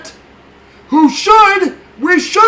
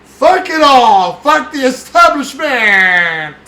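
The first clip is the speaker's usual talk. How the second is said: snarling punk; 6 dB louder, much slower than usual. Real, not acted. Shouted sign-off.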